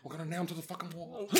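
fast, laughing, hushed threat; Giamatti in Billions. Retold or imitated. Imagining Giamatti in a Rhino suit, fast hushed threat. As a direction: rush it like a Wall Street shark. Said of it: "imagine him in a Rhino suit".

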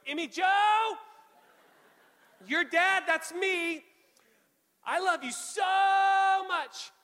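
high, loud shout with long-held words; screaming introduction. Retold or imitated. Dad introducing himself to his unborn daughter, screaming. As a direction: holler it high, stretching "so" right out. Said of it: "Why are you screaming?".

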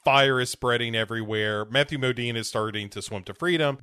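normal talking voice

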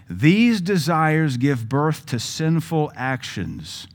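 slow, deliberate Bible reading; scripture reading. Shown as a demonstration. Preacher reading James to the congregation, slow and deliberate. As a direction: read each word with weight. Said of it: "let's keep reading".

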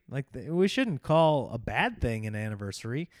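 ordinary delivery

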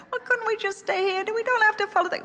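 put-on a child begging not to move on; pleading child.

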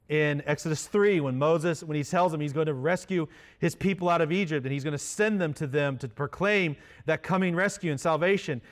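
usual speaking voice

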